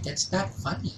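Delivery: high, soft mock reproof; a hint of a reproving grandmother; imitated disapproving grandmother. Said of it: "here's my grandmother".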